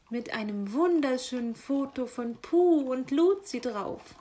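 delighted gushing with big swoops; enthusiastic magpie. Acted. Magpie showing off her new calendar, thrilled. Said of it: "Begeistert wedelte sie mit dem Kalender".

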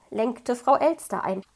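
plain reading voice

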